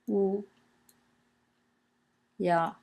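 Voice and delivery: "wu ya" with both syllables high and level; first tone. Shown as a demonstration. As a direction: say "wu", pause, "ya", each held in a level first tone. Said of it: "both in the first tone".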